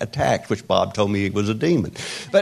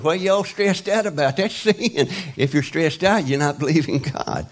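high, mock stern preacher voice; higher mock rebuke of stressed believers; imitating Bob; 7 semitones higher than usual. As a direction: send up the pastor's scolding. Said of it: "he goes".

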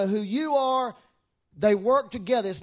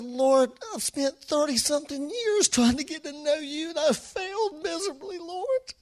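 plaintive plea climbing higher; agonized prayer; 8 semitones higher than usual. Retold or imitated. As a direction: confess failure to the Lord, voice rising in anguish. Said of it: "I'd been agonizing".

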